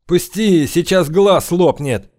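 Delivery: strained high rasp in pain; hoarse. Acted. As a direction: wheeze it out in pain. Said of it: "прохрипел главарь".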